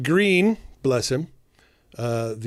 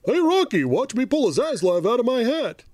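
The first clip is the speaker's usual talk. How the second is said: Bullwinkle-style cartoon voice; 7 semitones higher than usual. Bullwinkle parody.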